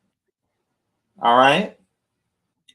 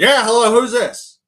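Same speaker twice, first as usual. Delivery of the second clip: much higher and louder, brusque; gruff guy answering; 12 semitones higher, 11 dB louder than usual. Retold or imitated. Dialer callee picking up impatiently, loud and brusque. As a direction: bark it like an annoyed callee. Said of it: "you talk to one guy".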